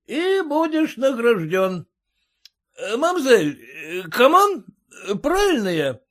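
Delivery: tipsy merchant flirting. A narrator's reading. Merchant addresses the Frenchwoman.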